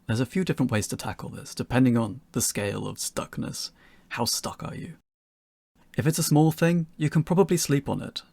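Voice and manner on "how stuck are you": breathy whisper, no voice at all; whispered question. Acted. Narrator teasing stuck developers, whispered. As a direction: lean in and whisper it conspiratorially.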